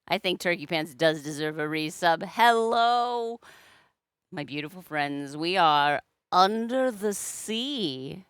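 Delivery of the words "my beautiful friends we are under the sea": ordinary talk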